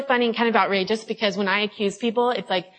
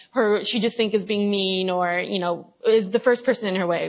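ordinary talk

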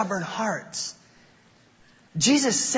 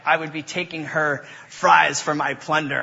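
ordinary talk, nothing marked

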